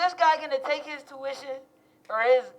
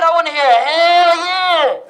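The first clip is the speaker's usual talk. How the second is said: slow, loud call to the crowd; crowd hype shout; 5 semitones higher, 10 dB louder, slower than usual. Real, not acted. Student hyping the rally crowd for a hell yeah, loud slow call. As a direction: pump the crowd up, stretch it out.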